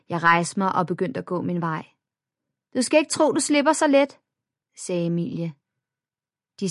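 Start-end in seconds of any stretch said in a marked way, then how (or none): 2.8-4.2 put-on threatening girl. A narrator's reading. Bully threatens as she leaves.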